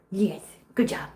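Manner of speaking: whispered praise to the kitten. Real, not acted. Owner whispering praise to a kitten, hushed. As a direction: breathe it softly, no voice.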